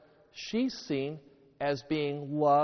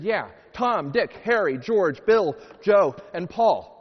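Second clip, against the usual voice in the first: loud, higher-pitched punched list; eager pupil; 5 semitones higher, 9 dB louder than usual. Acted. Pupil naming the seven demons to teacher, loudly eager. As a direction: belt out each name as a punchline.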